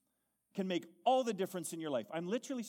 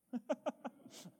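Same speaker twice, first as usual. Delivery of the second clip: joyful laugh; 6 semitones higher, 5 dB quieter than usual. Real, not acted. Preacher laughs after exhortation.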